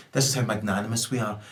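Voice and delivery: quietly oily PR statement; lightly voiced executive smoothing it over for the press; mock corporate spin. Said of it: "photo opportunity to say".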